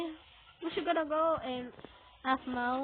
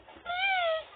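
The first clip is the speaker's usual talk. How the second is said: very high, thin whine; scared pleading. Real, not acted. Scared player begging for help, high whine. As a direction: beg in a high squeal.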